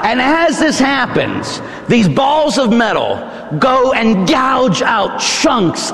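high, impassioned pulpit delivery; forceful preaching. Real, not acted. Preacher describing the scourging to the congregation, forceful high preaching. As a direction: drive each horror home to the congregation.

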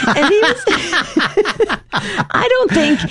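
high, breaking into laughter; words lost in a real laugh. Real, not acted. Laughs remembering son.

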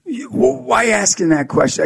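high-pitched, halting, taken-aback retort; defensive kid. Retold or imitated. Kid balks at the personal question.

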